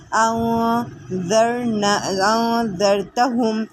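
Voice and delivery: very slow tajweed recitation, each sound drawn out; Quranic recitation. Shown as a demonstration. Teacher reciting for students, drawn out. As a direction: chant it slowly and clearly.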